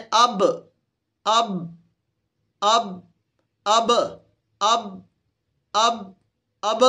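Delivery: "اب" stopped short on b; short "ab" for each "اب", lips closing on b; letter made vowelless. Shown as a demonstration. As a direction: drill "اب" with a firm closing b, pausing after each. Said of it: "اس پر جزم لائیں".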